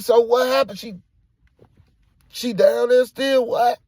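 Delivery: loud, high, alarmed questions; urgent, broken-up demand; frantic questioning. Retold or imitated. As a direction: yell for answers in shock.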